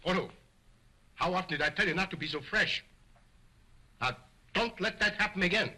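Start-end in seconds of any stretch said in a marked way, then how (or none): none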